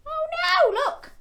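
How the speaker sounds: high squealed oh no with a laugh; mock alarm, laughing. Mock alarm at spitting llama.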